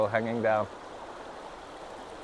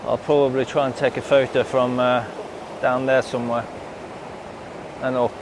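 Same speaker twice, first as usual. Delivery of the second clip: beside rushing river. Real, not acted. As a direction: speak up over the rapids. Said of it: "Hopefully you can hear me over the water here".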